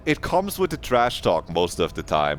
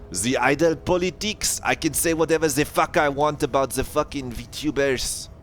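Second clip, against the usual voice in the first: excited; 6 semitones higher than usual. Real, not acted. Ranting about VTuber politics.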